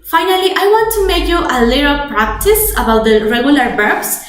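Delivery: ordinary delivery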